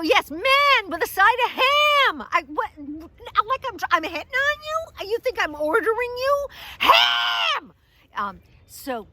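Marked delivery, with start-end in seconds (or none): 0.0-2.3 high, loud, drawn-out order; comic bellow across the counter; exasperated shout. Retold or imitated. 2.3-7.7 high, loud, hammed-up indignation; mock-furious rant at full volume; outraged shouting. Acted outraged quarrel at counter.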